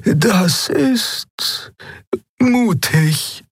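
very high-pitched, with a hesitant gap; high voice, pausing before the verdict; Xea's character voice. A narrator's reading. Xea reacting to the admiral's plan, high and hesitant. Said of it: "sagte Xea".

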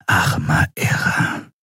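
slow, voiceless whisper; aghast whisper. A narrator's reading. Shiar staring at the dead captive, stunned slow whisper. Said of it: "flüsterte Shiar entgeistert".

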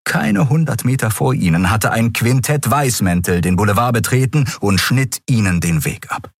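ordinary delivery, nothing marked

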